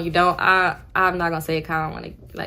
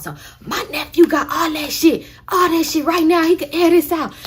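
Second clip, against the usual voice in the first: high, loud, throat-straining brag; loud strained aunt; 8 semitones higher, 3 dB louder than usual. Retold or imitated. Aunt brags to the cashier in a high, loud, strained voice. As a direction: belt it out like a rowdy aunt bragging. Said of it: "I'm hurting my throat doing that".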